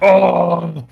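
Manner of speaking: high, loud, drawn-out yell; loud cry. Acted. Attacker after smashing the staircase, loud high cry. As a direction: bellow it as the raging brawler.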